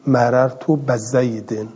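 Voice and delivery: بزیدن said "ba-zaydin", drawn out slowly; slow citation with "ba" at the start of بزیدن; fatha on the ب. Shown as a demonstration. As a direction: open the ب of بزیدن to "ba".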